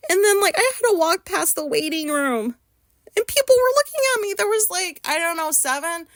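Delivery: the words spill out in a rush of embarrassment; flustered. Real, not acted. Telling viewers about walking past the waiting room, flustered and loud. Reliving the walk of shame.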